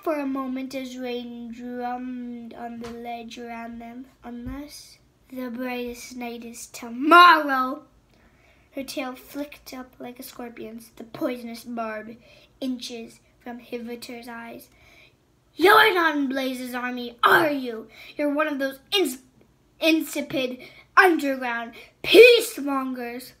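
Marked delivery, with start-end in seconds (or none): 4.2-7.9 sudden realization. Acted. Queen realizing the plot. 15.5-23.3 loud, scornful accusation; loud sneering queen; contemptuous sneer. Acted.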